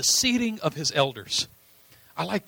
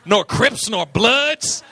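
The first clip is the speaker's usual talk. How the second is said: [name] voice; 5 dB louder than usual. Retold or imitated. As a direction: shout it like a gangster rapper. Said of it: "just going gangster".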